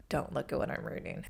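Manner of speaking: embarrassed whisper. Retold or imitated. Hiding her dragon book from other diners, whispered. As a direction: whisper it so nobody notices.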